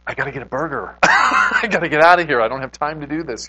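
high, loud, frantic self-mockery with a laugh; playing your panicked past self who must get out; flustered hurry. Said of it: "And I'm like".

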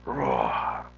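low, imitated roar; lion's roar. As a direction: rumble out a mock lion roar. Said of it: "they're hearing this roar".

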